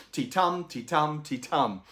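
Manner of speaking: ti-tum, stress on tum each time; ti-tum rhythm. Shown as a demonstration. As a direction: beat out the iamb, ti-TUM. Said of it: "soft syllable, loud syllable".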